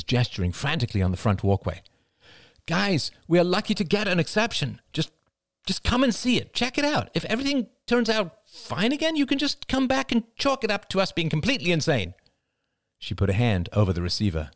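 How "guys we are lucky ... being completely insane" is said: high-pitched, urgent female character voice; much higher, insistent pleading; pleading wife. A narrator's reading. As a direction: plead with them down the phone.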